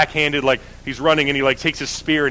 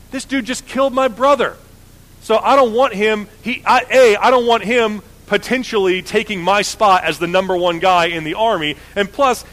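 indignant complaint; 6 semitones higher than usual. Retold or imitated. Joab complains angrily to David.

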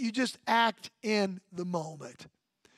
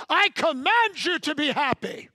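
mock command to child; 3 semitones higher than usual. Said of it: "saying to your child".

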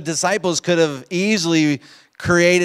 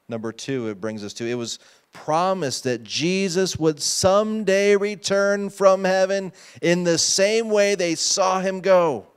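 ordinary delivery

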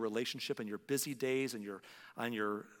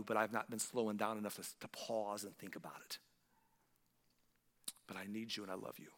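softer and softer to a near hush; fading tearful prayer; 4 semitones lower, 7 dB quieter than usual. Retold or imitated. Preacher reliving roadside prayer, fading to hush. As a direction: let the prayer sink away.

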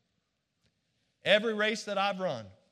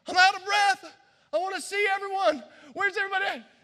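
much higher and louder, eager and out of breath; breathless excitement; 10 semitones higher, 4 dB louder, faster than usual. Retold or imitated. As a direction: rush it like a runner just finishing. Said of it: "I'm like".

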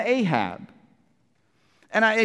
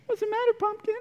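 cooing wife; 13 semitones higher, 6 dB quieter, faster than usual. Retold or imitated. Jezebel consoling her sulking husband, high soft coo. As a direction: coo over him like a pouting child. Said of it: "your wife has a nickname from you".

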